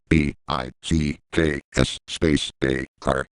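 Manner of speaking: slow, low letter-by-letter spelling; spelled out. Shown as a demonstration. Showing viewers how to separate the word, spelled out slowly. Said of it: "if you separate the word, as follows".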